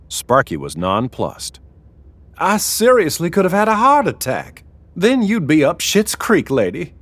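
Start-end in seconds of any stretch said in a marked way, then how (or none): 2.4-6.9 high-pitched, aggrieved man; indignant protest. Acted. Sparky protests the prank indignantly.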